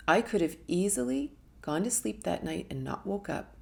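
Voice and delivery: ordinary talk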